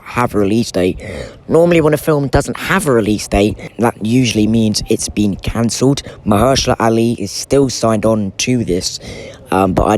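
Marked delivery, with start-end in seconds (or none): none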